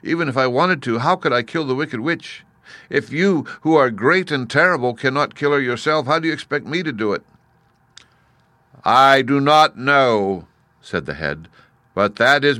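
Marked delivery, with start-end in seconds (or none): 0.0-7.2 put-on higher, lightly tearful child voice; a touch of tearful pleading; mildly sobbing girl. A narrator's reading. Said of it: "she sobbed". 8.8-10.5 loud, high, very slow challenge; imperious, drawn-out giant voice; booming Great Head. A narrator's reading. Great Head refuses to help.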